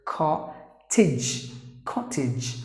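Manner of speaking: "cottage" as KOT-idzh, short i, one t; model pronunciation. Shown as a demonstration. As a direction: say "cottage" slowly with a short final "idge". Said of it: "you have to pronounce the word as".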